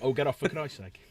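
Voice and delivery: Brummie grumble at an ordinary volume; understated repeat of a Birmingham accent. Acted. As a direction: toss off the punchline in a Brummie accent. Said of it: "for the Brummie accent".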